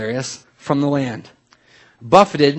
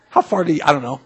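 ordinary talk, nothing marked

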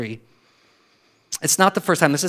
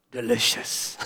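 low, soft, drawn-out delicious; savoring king; 6 semitones lower, 11 dB quieter, slower than usual. Retold or imitated. King tasting the candy, low soft delight. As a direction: savor the word like a contented king. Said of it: "he goes".

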